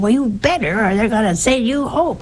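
lightly voiced stern infirmarian pressing her; mock-stern nun laying down the rule; insistent nurse.

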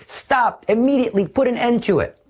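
a high, urgent order to stop, then fast; preacher cutting off the tempter, fast and urgent; sharp command. Acted. Preacher orders the listener to stop sinning, high and urgent.

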